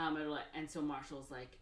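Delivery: ordinary talk, nothing marked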